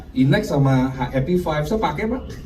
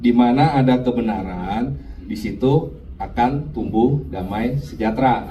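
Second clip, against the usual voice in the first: low, slow scripture reading, phrase by phrase; slow, careful reading; 10 semitones lower, slower than usual. Shown as a demonstration. Preacher shows the congregation how to read the verse, slow and low. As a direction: read it out slowly, stretching each phrase. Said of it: "baca pelan-pelan".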